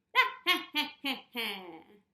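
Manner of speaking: loud cackling witch laugh; loud witch cackle. Shown as a demonstration. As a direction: cackle like an old hag. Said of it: "I'm gonna make my really loud witch cackle".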